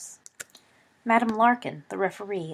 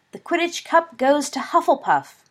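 loud, higher stadium announcer call; announcer's cry; 8 semitones higher, 6 dB louder than usual. A narrator's reading. Announcer declaring the Cup winner to the stadium, loud and high. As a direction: proclaim it to the whole crowd. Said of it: "cried the announcer".

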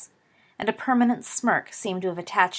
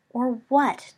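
higher, challenging demand; 5 semitones higher, 4 dB louder than usual. A narrator's reading. Sirius squaring up to Snape, demanding. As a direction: dare him to carry out his threat. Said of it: "demanded Sirius".

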